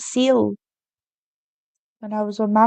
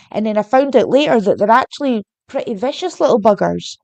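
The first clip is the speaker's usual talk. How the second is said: ordinary talk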